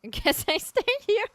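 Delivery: high, loud silly turtle voice. Retold or imitated. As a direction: squeak it out as a lazy turtle. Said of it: "Turtle be like".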